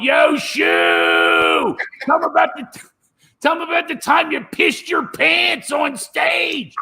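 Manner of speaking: high, rowdy yelled heckle; drunk heckler. Retold or imitated. Drunk friends heckling comic on stage, rowdy high yell. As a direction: bellow it from the cheap seats.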